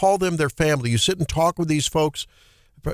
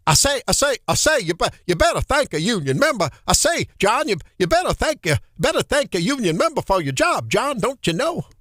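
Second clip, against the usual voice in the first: exaggerated Southern preacher twang, pitched up; mock Southern drawl; 5 semitones higher than usual. Radio host mimics the candidate's Southern accent from the clip, hammed-up drawl. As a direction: ham up a folksy Southern accent, drawing out the vowels. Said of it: "I better stick to the day gig".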